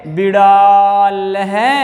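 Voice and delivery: छात्र है drawn out, visarga breathed as है; slow visarga reading. Shown as a demonstration. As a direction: model it slowly. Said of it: "क्या बोलेंगे".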